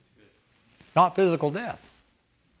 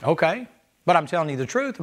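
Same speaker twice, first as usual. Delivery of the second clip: ordinary delivery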